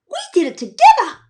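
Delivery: high, fast, bright-voiced buddy; cheery Jack voice. Jack answering Evie, high and fast. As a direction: sing it out happily.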